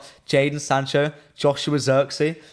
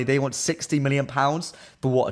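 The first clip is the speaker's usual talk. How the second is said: ordinary talk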